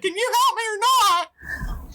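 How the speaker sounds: high, drawn-out gnome demand; exasperated little creature voice; impatient gnome. Acted. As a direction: plead up high, fed up and insistent. Gnome demands help from player.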